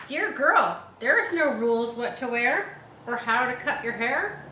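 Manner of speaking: put-on reading to children. Teacher reading her favourite page, loud and bright. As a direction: belt out the page like a favourite story. Reads picture book aloud.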